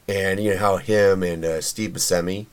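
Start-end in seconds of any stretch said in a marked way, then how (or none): 1.6-2.5 "Buscemi" with a plain s, boo-SEM-ee; first pronunciation. Shown as a demonstration. Host unsure of the name, "Buscemi" said with s. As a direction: say "Buscemi" with s and a slight questioning rise. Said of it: "or is it Buscemi".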